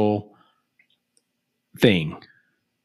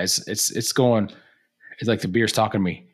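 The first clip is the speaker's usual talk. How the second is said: ordinary delivery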